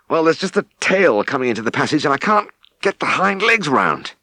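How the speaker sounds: a farmer struggling mid-calving; worn-out farmer. Acted. Exhausted farmer explains problem.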